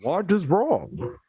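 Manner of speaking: slow masked villain growl; Bane voice. Pretend. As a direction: drag it out like Bane. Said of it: "I kinda wanna do that voice".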